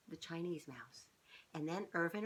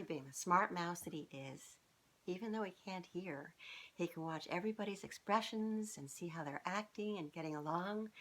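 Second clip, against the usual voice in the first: usual speaking voice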